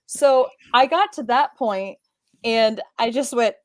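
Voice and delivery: ordinary talk, nothing marked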